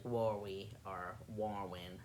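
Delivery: "Worry" for Lori, L turned to W; soft "Worry" and "Warwin", childlike W replacing L. Retold or imitated. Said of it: "They were always with a W".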